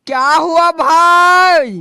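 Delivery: lightly voiced cauliflower, drawn out slow. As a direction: answer slow and lazy as the cauliflower. Cauliflower answers its friends. (धीमी, खिंची हुई गोभी की आवाज़)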